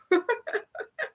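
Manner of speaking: real high, light giggle. Real, not acted. Laughs after mock insult.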